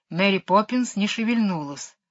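plain reading voice

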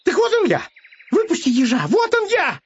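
very high, excited cries; excited hare. A narrator's reading. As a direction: shout it high, full of bravado. Hare shouting at the wolf. (задорные крики зайца высоким голосом)

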